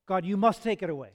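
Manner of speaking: emphatic plea. Acted. Preacher voices Paul's prayer to God, emphatic plea. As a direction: plead it out, leaning hard on the key words.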